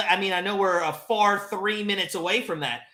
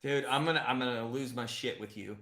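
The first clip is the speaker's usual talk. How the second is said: tense muttered threat; 11 semitones lower, 13 dB quieter than usual. Real, not acted.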